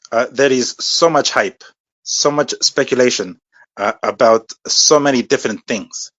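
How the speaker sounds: put-on slow, failed Mexican accent sliding into Russian; accent that drifts Russian. Trying a Mexican accent, host hears Russian. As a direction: aim for Mexico, land in Russia. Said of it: "Are you Russian?".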